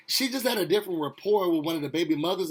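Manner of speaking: normal talking voice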